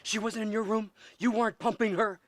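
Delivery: high, loud, incredulous questions; heated accusation. Acted. Director accusing actor of sleeping with a woman, heated. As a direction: hurl the questions at him.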